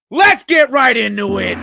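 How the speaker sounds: high, loud hype call; rally the chat; hype shout. Real, not acted. Hype shout starting segment.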